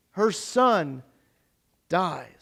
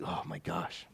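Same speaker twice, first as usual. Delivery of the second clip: usual speaking voice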